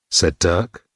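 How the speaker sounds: usual speaking voice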